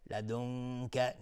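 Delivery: slow «لدنک» with the noon held as a hidden hum before the kaf; ikhfa of noon. Shown as a demonstration. Teacher demonstrates ikhfa, noon of «لدنک» hummed before the kaf. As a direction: hide the noon of «لدنک» in a long nasal hum. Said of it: "نون ساکن بهش کاف اخفای حقیقی".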